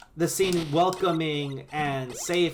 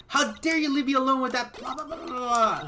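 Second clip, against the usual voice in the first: high mock outrage; 7 semitones higher than usual. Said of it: "Pistol is like".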